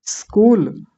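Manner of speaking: स्कूल from a pure hissed s; no i before स्कूल; correct स्कूल. Shown as a demonstration. Teacher answering how to say it, correct स्कूल. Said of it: "कैसे बोलेंगे?".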